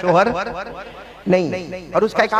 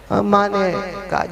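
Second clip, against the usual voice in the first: ordinary talk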